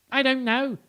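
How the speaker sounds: squeaky squirrel answering the deer. Acted. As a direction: pipe it up like a small squirrel. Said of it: "said a squirrel".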